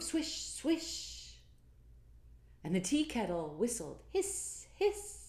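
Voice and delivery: high whispered swish, then hiss. Acted. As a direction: make the leaves and kettle sounds. Sound effects in refrain.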